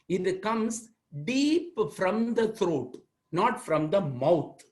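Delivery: "deep" drawn out slowly, stressed and falling; slow down and lean on "deep"; slow emphatic explanation. Shown as a demonstration. Teacher stressing the vowel sound comes deep from the throat, slow and emphatic.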